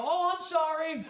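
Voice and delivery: high, slow, sugary apology; mock politeness. Comedian fakes a polite apology at the door, high and slow. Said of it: "And then I go".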